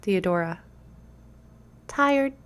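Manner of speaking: little leaf spirit speaking; pixie. A narrator's reading. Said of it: "you hear a tiny voice say".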